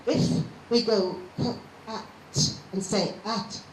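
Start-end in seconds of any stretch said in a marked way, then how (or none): 1.4-2.1 k, at pulled apart with a pause; cat in two separated bits, k and at; sounding out. Shown as a demonstration. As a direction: say k alone, then at. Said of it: "the first bit has dropped off".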